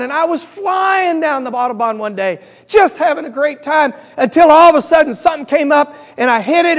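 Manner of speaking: high, animated joke character; excited retelling. Acted. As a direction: relish the fast car ride as you tell it. German's excited tale in joke.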